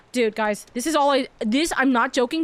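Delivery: ordinary talk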